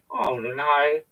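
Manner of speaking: ordinary delivery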